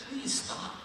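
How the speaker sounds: whispered, high please stop; wife quietly begging the heckler to stop; hushed plea. Retold or imitated. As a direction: breathe it out as a tense whisper. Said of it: "she said".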